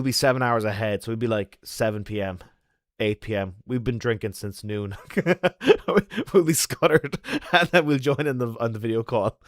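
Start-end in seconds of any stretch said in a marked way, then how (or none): 5.1-9.4 talking through a laugh, higher and louder; giggling as you picture it. Real, not acted. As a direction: crack up while telling the plan. Amused by drunk family video call.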